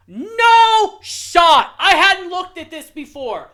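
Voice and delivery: loud, high disbelieving yell; real outraged shout. Real, not acted. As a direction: blow up at the list in real outrage. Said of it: "I'm fucking heated".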